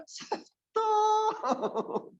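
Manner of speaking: very high, stretched-out "stop"; slow Solo attendant. Acted. Preacher mocking late parking warning, languid high drawl. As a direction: let the warning drag out, high-pitched. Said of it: "Udah kejeduk gitu ya".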